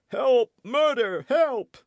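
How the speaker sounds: high, loud shrieks of panic; terrified cries for help. A narrator's reading. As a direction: scream for your life. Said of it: "cried he".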